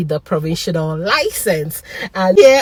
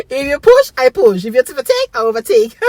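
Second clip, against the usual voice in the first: playful swagger; 6 semitones higher, 4 dB louder than usual. Real, not acted. Boasting as new driver.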